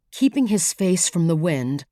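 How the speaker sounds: ordinary delivery, nothing marked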